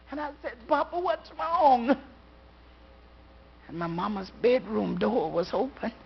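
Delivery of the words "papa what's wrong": put-on frightened child. Frightened child asks father.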